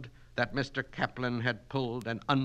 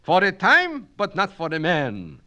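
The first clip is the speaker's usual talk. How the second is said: high, loud thundering retort; 10 semitones higher, 12 dB louder than usual. Acted. Kaplan thundering back at Miss Mitnick. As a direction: boom out the correction. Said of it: "thundered Kaplan".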